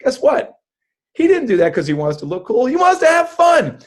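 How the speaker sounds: rising to a high, loud shout; excited shout. Real, not acted. Magician tells host why hosts hire him, climbing to an excited shout. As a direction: build to a gleeful, loud payoff. Building up to his main point.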